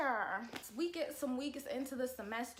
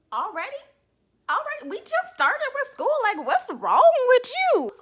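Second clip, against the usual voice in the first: high, loud shout, building to a stretched-out yell; outraged disbelief; 17 dB louder than usual. Acted. Student yelling at professor over an essay, outraged disbelief. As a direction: yell it in hammed-up outrage, voice pitched way up. Acted outburst at demanding professor.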